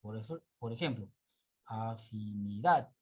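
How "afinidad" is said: afinidad drawn out slowly, last syllable DAD punched; stress on final syllable. Shown as a demonstration. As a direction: stretch afinidad and land hard on -DAD. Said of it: "Nosotros no leemos afinidad, sino afinidad".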